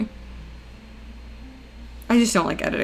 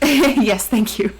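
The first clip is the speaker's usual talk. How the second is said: laughing; 2 semitones higher, 4 dB louder, much slower than usual. Real, not acted. Laughing at own voice crack.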